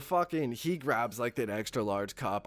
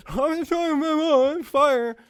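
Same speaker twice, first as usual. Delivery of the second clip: high, loud, slow panicked wail; hammed-up shriek of panic; mock panic; 10 dB louder, slower than usual. Walking into the sandwich shop, mock panicked wail. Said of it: "come out all panicky".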